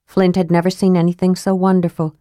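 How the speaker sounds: ordinary delivery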